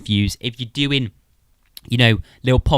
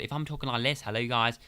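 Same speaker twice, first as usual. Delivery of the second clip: much softer, turned away; soft off-mic talk; 9 dB quieter than usual. Shown as a demonstration. Showing the signal dropping, much softer off-mic. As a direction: talk softly off to the side. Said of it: "I have to talk a little bit louder".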